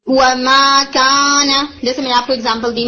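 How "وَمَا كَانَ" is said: وَمَا كَانَ recited slowly with a nasal ring; nasal recitation. Shown as a demonstration. Tajweed teacher demonstrating recitation slowly.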